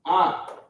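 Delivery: Ah as an open, clear ah vowel; letter demo. Shown as a demonstration. Teacher sounding the letter for students, a clear Ah. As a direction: sound out Ah for the class. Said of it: "This is how we pronounce it".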